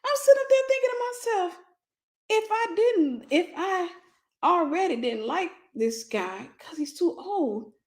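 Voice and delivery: strained falsetto telling; high put-on voice. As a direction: keep the squeaky high voice going.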